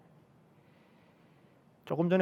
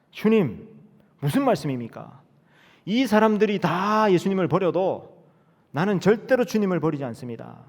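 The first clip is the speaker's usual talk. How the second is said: loud boastful vow; 6 semitones higher, 5 dB louder than usual. Acted. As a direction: swear it loudly over the others. Peter boasting loyalty.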